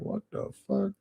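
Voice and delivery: quietly imitating his own shock, pitch way up; retold disbelief. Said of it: "I'm like".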